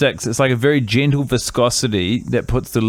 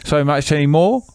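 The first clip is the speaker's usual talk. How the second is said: put-on English accent, higher; [name]'s English accent; 4 semitones higher than usual. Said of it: "What part of England are you from, [name]".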